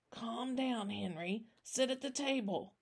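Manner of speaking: put-on soft, steadying old-man voice; calming. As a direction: settle a frantic friend gently. Soothing a shaken man.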